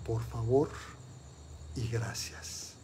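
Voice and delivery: soft, low murmur fading away. Acted. Mysterious voice in the tree murmurs to the boy, softly. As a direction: murmur it from the shadows. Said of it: "se oye un murmullo que dice".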